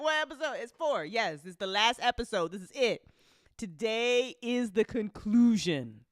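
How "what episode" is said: higher, laughing, mock baffled; imitating confusion. Host mocking her own confusion to chat, high. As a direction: play your own confused self. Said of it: "I'm over here like".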